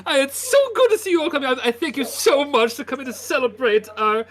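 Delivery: high, loud, giggly host; beaming mayor welcoming visitors; jolly mayor. Acted. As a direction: fuss over the guests with glee.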